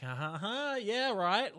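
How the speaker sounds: high, loud mock laugh into a jeer; hammed-up knowing scoff, rising in volume; tongue in cheek. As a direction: send it up with a fake ha-ha-ha. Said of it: "tongue in cheek".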